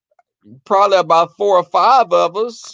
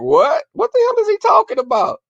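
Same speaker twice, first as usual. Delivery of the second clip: high-pitched retold bewilderment; baffled reaction. Thinking to oneself about the boyfriend's question, baffled. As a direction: replay total confusion, pitch shooting up.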